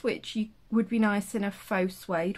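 ordinary talk

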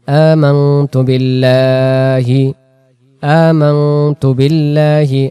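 slow recitation. Shown as a demonstration. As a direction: recite each word stretched and clear. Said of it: "কালিমায়ে ইমানে মুজমাল".

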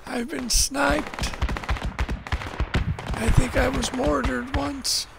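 high, slow whiny complaint; mock despair. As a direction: whine it like a beaten soldier. Listing his many deaths.

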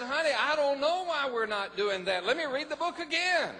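loud, high, laughing reply; husband answers wife, laughing loudly. Retold or imitated. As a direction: chuckle through it, excited.

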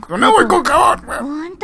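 high-pitched mocking repeat; high mock squeal. Real, not acted. Player mocking the game's handwashing line, high and mocking.

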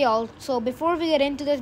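ordinary talk

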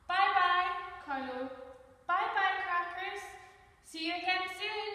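high, singsong farewell call; calling goodbye. Acted. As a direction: call out cheery goodbyes to departing visitors. Librarian waves Carlo goodbye.

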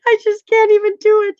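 high, helpless laughing cry, slowed right down; crying with laughter; laughing to tears. Real, not acted. Laughing so hard at her friend that she cries. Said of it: "Now I'm crying".

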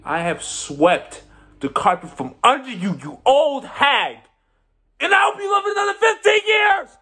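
high, loud tirade of insults; shouted triumph, easing in volume but staying high-pitched; furious gloating. Acted. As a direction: explode at your enemy, voice leaping way up. Gloating insults hurled at enemy.

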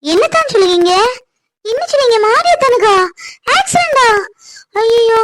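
high, distressed cry of disbelief; shocked alarm. Acted. Ponni hearing of her cousin's accident, shocked alarm. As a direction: let the questions leap up in panic.

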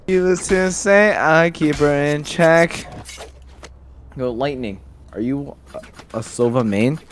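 usual speaking voice

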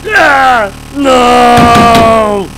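high, loud wails of despair; loud shrieking no; anguished screaming. Acted. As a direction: scream as the screen goes blue. Computer crashes to blue screen.

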